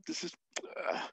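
a quick breathy whisper to himself; whispered aside. Real, not acted. Lecturer whispering an aside before calling it complicated. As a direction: drop to a hush for a beat.

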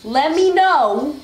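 slow, stretched-out words; emphatic repeating. Real, not acted. Player repeating a misheard phrase, slow and emphatic. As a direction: spell it out word by word.

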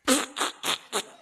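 muffled snort behind a hand; stifled laugh cough. Retold or imitated. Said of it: "had his hand on his mouth going".

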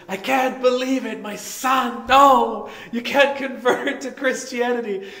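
comic disbelief at his son; hammed-up dismay; upset father. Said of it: "He says".

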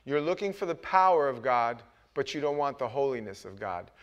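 ordinary delivery

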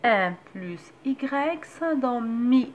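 each sound drawn out, clear and slow like a reading teacher; slow syllable blending. Shown as a demonstration. Teacher showing mothers how to blend m and a vowel, slow and clear. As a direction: sound the syllable out slowly so a child can follow. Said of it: "غنطقها بحال غنطق اي".

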